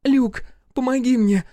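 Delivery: высокий испуганный крик; кричит в отчаянии, высоко и громко; крик о помощи. A narrator's reading. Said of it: "закричала она". (демон в облике Амелии зовёт Люка на помощь)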